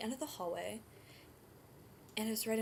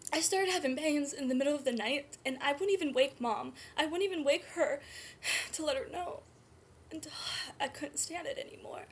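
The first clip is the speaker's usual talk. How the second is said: urgent, raised, upset voice; distressed; 3 semitones higher, 4 dB louder than usual. Acted. Birth mother confesses hiding labour pains from Mom, distressed. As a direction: pour it out, close to tears.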